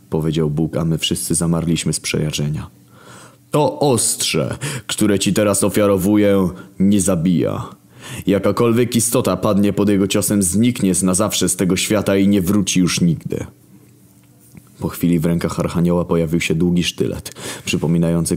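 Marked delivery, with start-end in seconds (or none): 3.5-13.4 lofty raised voice of the Creator; wounded divine authority; God. Acted. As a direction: speak down from heaven, aggrieved. God presents blade.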